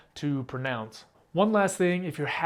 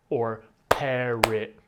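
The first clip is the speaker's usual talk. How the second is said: frighten slowly, louder, beat by beat; slow syllable split; 2 semitones lower, 4 dB louder, much slower than usual. Shown as a demonstration. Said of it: "you clap for each sound".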